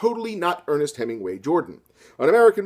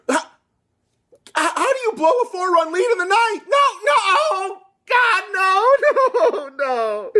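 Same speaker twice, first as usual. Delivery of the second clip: much higher, louder frantic wailing; panicked fan; 5 dB louder than usual. Acted. Fan despairing over a blown ninth-inning lead, frantic wail.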